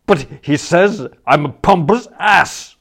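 loud, high, wounded protest; injured loud whine; aggrieved bully. Acted. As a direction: complain like a hurt big man. Spode aggrieved complaint.

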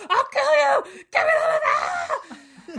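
high, loud, slow mock shout; mock addict shout. Meth heads running out, mock desperate shout. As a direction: yell it like a desperate meth head. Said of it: "And they're just like".

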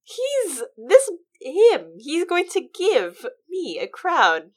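high, slow mocking impression of an outraged king; mocking Balon. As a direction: squeal it out in disbelief, like a pompous king.

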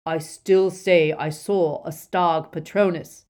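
loud, slow, insistent shout; emphatic Death Eater's shout. A narrator's reading. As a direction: insist on it at full voice. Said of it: "shouted the first Death Eater".

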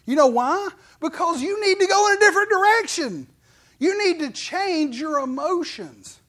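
rising, loud sermon delivery; preaching at full force. Acted. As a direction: lift it up like a revival preacher.

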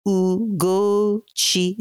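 Ugochi drawn out slowly, each syllable sustained; song-like. Shown as a demonstration. Guest teaching the name to listeners, Ugochi slow and song-like. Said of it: "it's almost like a, s-, a song".

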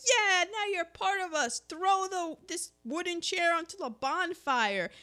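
put-on a kid welcoming the new member; eager welcome. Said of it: "she's like".

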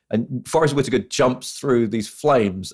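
ordinary talk, nothing marked

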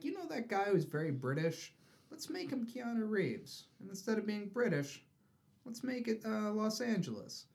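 lightly mock their breezy pitch; mock studio pitch. Mocking movie executives' pitch.

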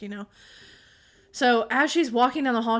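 usual speaking voice

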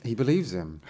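plain reading voice

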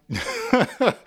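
short, high amused laugh. Real, not acted. Teacher amused by own insult example, laughing. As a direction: break into a laugh after the joke.

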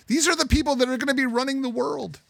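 high-pitched, exasperated disbelief; incredulous outrage. Real, not acted. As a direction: pitch jumps way up in dismay. Incredulous at the interviewed students.

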